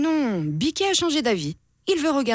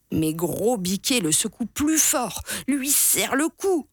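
ordinary delivery, nothing marked

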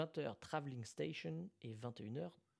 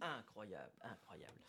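softer and lower, dying away; fading echo; 5 semitones lower, 8 dB quieter than usual. Pretend. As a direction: let it fade out like an echo. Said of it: "déclame en mode, euh, théâtre?".

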